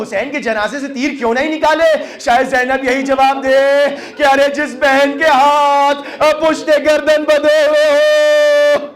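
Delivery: high cry building into a long wail; climactic majlis lament. Acted. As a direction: wail the last words out in mourning.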